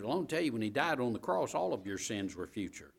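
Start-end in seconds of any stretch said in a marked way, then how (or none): none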